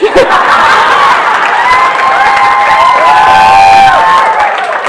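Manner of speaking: huge high shrieking laugh; a loud shrieking cackle of laughter. Real, not acted. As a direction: let out a screaming laugh. Big laugh after the punchline.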